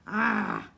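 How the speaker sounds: short sharp scream of pain. Acted. Solly doubled over, screaming in pain. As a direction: cry out as a cramp hits. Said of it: "Solly screamed".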